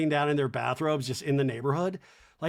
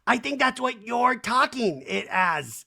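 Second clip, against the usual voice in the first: high, slow mock indignation; retold indignant retort; 8 semitones higher, 3 dB louder, slower than usual. Kyle arguing back at Dorit, mock indignant. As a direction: imitate a defensive housewife.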